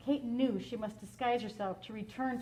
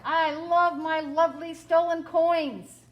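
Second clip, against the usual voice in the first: loud, slow, higher giant voice; gloating giant; 5 semitones higher, 7 dB louder than usual. Acted. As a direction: gloat over the gold like a greedy giant.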